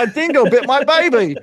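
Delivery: high, loud, laughing Aussie voice; Australian accent. Retold or imitated. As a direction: laugh through a mock Aussie line. Australian dingo joke, laughing.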